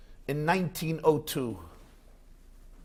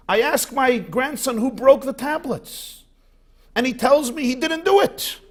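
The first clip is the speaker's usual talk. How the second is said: much higher, rising outrage; a hammed-up furious old man; indignant grandfather; 9 semitones higher than usual. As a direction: scold the teacher in mock indignation. Grandfather scolds teacher in joke.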